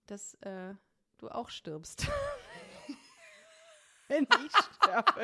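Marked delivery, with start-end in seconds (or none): 2.1-2.9 high laugh. Real, not acted. Laughing at own joke. 4.1-5.2 said through laughter, slow; laughing. Real, not acted. Joking about dying.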